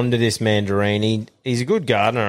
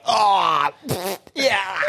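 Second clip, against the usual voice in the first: over-the-top spitting out; 9 semitones higher, much slower than usual. Retold or imitated. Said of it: "proper over the top".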